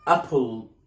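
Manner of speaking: "apple" said as "ap-pol"; added O. Shown as a demonstration. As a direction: slip an o before the l in "apple". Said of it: "بتحط O من عندك". (نطق "apple" بإضافة O)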